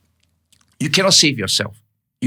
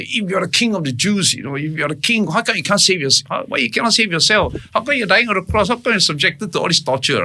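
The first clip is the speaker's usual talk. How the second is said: retold jeer, pitched higher; mocking taunt; 7 semitones higher than usual. Thief on the cross taunting Jesus, mocking and higher. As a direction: sneer it up at the man on the cross. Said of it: "everybody were mocking him and say".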